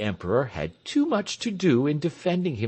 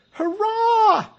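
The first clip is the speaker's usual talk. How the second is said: very high, loud hurrah; cheering shout; 6 dB louder than usual. Acted. Crowd cheering Tell's speech with a high loud hurrah. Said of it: "shouted everybody".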